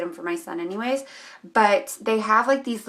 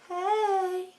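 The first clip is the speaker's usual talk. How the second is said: playful high hey; 10 semitones higher, 5 dB quieter than usual. Real, not acted. Joking about her forehead.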